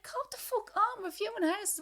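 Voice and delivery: high, exasperated scolding; mock exasperated scold. Scolding the imagined child at a viewing, high exasperated. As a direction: snap at the kid in mock exasperation.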